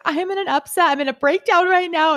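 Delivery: mock distressed. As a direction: play a friend falling apart, half joking.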